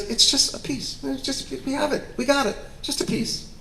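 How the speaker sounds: high, breathy-free coaxing voice, half whispered; high coaxing director. Retold or imitated.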